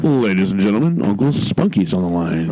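low radio host intro. Shown as a demonstration. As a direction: announce the caller in a deep radio voice. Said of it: "Give me your radio voice".

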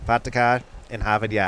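put-on copied Boston accent. Host echoing co-host's demo, imitated Boston accent. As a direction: try Boston with dropped r's. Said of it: "everybody has a Boston ac".

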